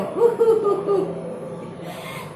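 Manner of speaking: high airy wheezing laugh; high breathy laugh. Shown as a demonstration. Teacher modelling a funny laugh for the kids, airy wheeze. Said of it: "આવી અવાજ કાઢીને તમારે હસવાનું છે".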